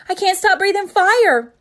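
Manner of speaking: loud, high panicked cry; panicked shout. A narrator's reading. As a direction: blurt it out in alarm. Father panicked.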